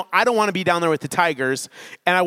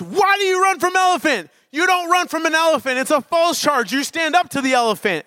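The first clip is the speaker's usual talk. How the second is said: high, indignant mock scolding; retold yell, pitched way up and insistent; imitated yelling; 12 semitones higher, 3 dB louder than usual. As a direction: act out the guide berating a tourist. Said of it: "starts yelling at me. He goes".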